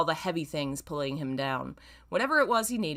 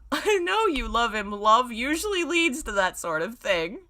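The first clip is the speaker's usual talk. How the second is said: reading on while still laughing, higher and louder; laughing through lines; 6 semitones higher, 4 dB louder than usual. Real, not acted. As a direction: fight back laughter as you read. Reader still laughing reading line.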